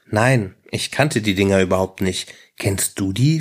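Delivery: ordinary delivery, nothing marked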